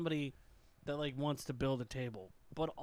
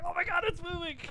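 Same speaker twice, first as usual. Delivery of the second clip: mock panic; 14 semitones higher, 4 dB louder than usual. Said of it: "They're like".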